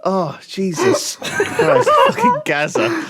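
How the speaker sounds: high, drawn-out words through laughing; helpless laughter. Real, not acted. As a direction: crack up at your own impression. Cracking up at own impression.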